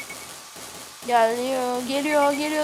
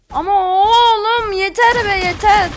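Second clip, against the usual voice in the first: whiny crying protest; 3 semitones higher, 5 dB louder than usual. Real, not acted. Keeps getting shot, whines.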